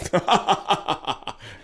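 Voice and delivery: mock maniacal cackle, high and staccato; maniacal laugh. Co-host mimicking the laugh at the video's start, mock maniacal. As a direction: imitate a maniacal laugh in a string of ha-has. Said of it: "kinda like a maniacal".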